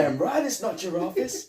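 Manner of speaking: high, put-on shouty boss voice; mock indignant boss yelling; angry boss. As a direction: bark it like an outraged chief. Quoting boss retort in scene.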